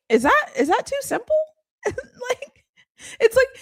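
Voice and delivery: high laughing question. Real, not acted. Laughing while asking if the advice is too simple. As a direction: laugh it out in disbelief.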